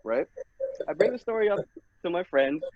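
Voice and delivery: ordinary talk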